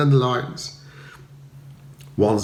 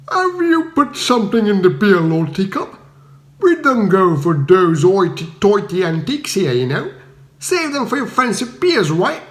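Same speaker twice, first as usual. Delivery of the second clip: high, louder cockney jeer; mock the posh lord; Cockney villager sneering at the earl; cockney accusation; 5 semitones higher, 3 dB louder than usual. A narrator's reading. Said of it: "a choir of expostulation".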